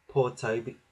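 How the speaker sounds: ordinary delivery, nothing marked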